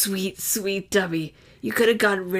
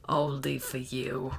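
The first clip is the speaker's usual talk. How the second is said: lower, softer and slower; tender repeat; 5 semitones lower, 7 dB quieter, slower than usual. Shown as a demonstration. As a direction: let it fall away tenderly. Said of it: "repeated softer".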